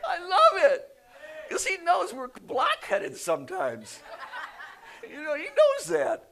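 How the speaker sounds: loud, high, delighted laughter through the words; laughing. Real, not acted. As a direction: preach through a big laugh. Preacher laughs amid congregation.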